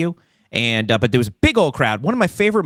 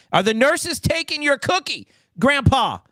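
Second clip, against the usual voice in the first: much higher, sing-song nurse talking down to grandpa; 13 semitones higher than usual. Pretend. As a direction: coo it like a carer teasing an old patient.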